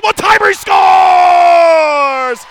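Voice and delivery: long stretched shout, very high and loud; goal call shout. Real, not acted. Goal call in hockey broadcast.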